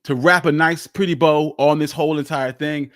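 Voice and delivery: ordinary delivery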